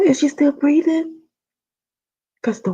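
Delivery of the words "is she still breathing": high worried question; scared she stopped breathing; anxious question. Retold or imitated. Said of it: "And I said".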